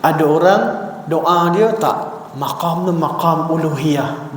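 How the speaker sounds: ordinary talk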